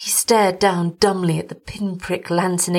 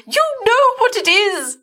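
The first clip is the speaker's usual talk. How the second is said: very high, louder, eerie voice; hollow dread; 4 dB louder than usual. A narrator's reading. Vacant-eyed wife tells husband he knows, high eerie dread. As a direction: say it as a doomed certainty.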